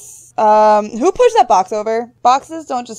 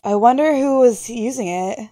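ordinary talk, nothing marked

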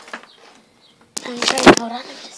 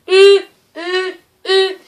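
high loud humming engine; car hum imitation; 6 semitones higher, 12 dB louder, much slower than usual. Shown as a demonstration. Girl imitating how a car sounds. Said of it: "this is how cars be".

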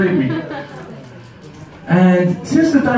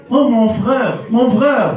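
street seller's call; 6 semitones higher than usual. Retold or imitated. Moroccan seller calling out.

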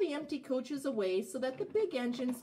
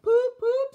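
high, loud poop poop; engine whistle; 12 dB louder than usual. A narrator's reading. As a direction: honk it like a big engine. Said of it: "said Gordon".

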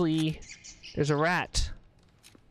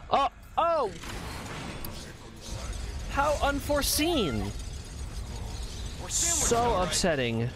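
sarcastic surprise; 7 semitones higher, 3 dB quieter than usual. Pretend.

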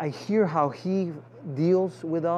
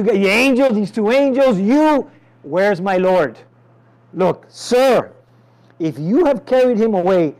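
loud, high, anguished pleading; distraught plea; 5 semitones higher, 11 dB louder than usual. Acted. Mary begging the gardener, loud and high. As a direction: cry out desperately for her Lord.

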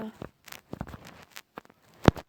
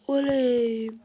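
ordinary talk

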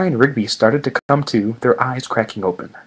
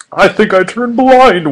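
mock horror; 8 semitones higher, 7 dB louder, slower than usual. A narrator's reading. Stranger mock-horrified.

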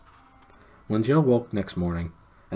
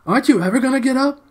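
much higher, louder call to wake the sleepers; loud shout; 10 dB louder, faster than usual. A narrator's reading. Jill calling sleepers awake.

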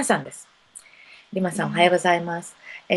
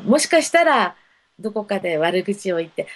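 ordinary talk, nothing marked

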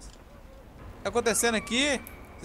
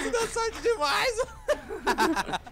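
much higher, words squeezed out through real laughter, slow; laughing disbelief; much slower than usual. Real, not acted. Teasing a lucky teammate, laughing through it.

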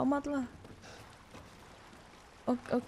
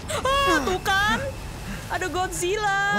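startled yelp; 9 semitones higher, slower than usual. Real, not acted. Gamer startled by the monster, yelping. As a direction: jump at the sudden monster.

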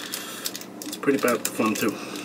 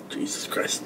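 whispered; 12 dB quieter than usual. Real, not acted. Reacting to burning candy.

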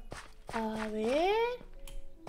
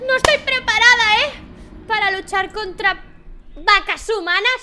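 very loud, higher shouting; panicked shout; 7 semitones higher, 12 dB louder than usual. Real, not acted. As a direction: yell it out, overwhelmed. Overwhelmed by attacking villagers. (gritando agobiada)

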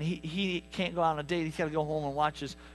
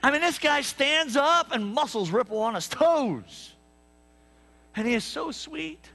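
gushing; 5 semitones higher than usual. Acted. Gushing over the dreamy boyfriend.

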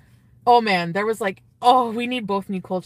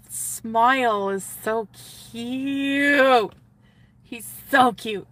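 gushing swoon; 2 semitones higher, 4 dB louder, much slower than usual. Real, not acted. Gushing about his smile.

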